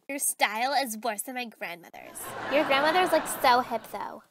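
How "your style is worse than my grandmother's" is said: ordinary talk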